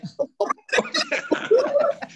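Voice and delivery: a long, high laugh. Real, not acted. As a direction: laugh off his own dark joke. Joke about the gravestone.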